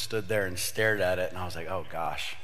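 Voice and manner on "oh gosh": normal talking voice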